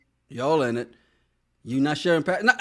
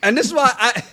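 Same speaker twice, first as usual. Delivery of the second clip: accusing; 5 semitones higher, 5 dB louder than usual. Acted. Host calls out the partner who knew, emphatic accusation. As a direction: hammer it home, pitch soaring.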